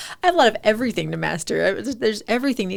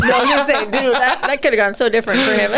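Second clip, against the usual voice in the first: higher and louder, laughing as she jumps in; excited reaction; 5 semitones higher, 7 dB louder than usual. Real, not acted. Host reacting to the campus fight story, excited and laughing. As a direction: burst in with amazed excitement.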